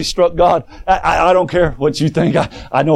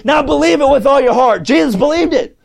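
full-force preaching; 7 semitones higher than usual. Acted. Preacher rallying the church against unbelief, shouting. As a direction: preach it hard to the congregation.

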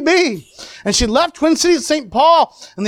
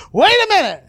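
mild, higher-pitched mock holler; retold holler; 8 semitones higher than usual. Father calling Billy back at the gate, retold holler. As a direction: imitate calling after someone, without full volume. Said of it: "and I hollered at him".

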